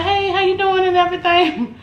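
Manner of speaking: high, bright customer voice on the phone; butter up the agent; sweet phone voice. Retold or imitated. Calling the cruise lady about a price drop, high sweet phone voice.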